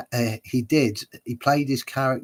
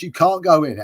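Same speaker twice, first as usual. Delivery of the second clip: louder, higher mock objection; mock heated; 7 semitones higher, 8 dB louder than usual. Taxi driver stopping his passenger, mock heated. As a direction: imitate a driver getting heated. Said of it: "it got heated a bit".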